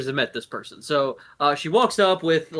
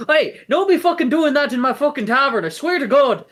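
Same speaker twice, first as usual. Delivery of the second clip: higher and louder, a furious Scottish barkeep; angry tavern owner; 12 semitones higher, 5 dB louder than usual. Acted. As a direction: yell at the guy who broke her table. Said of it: "and just goes".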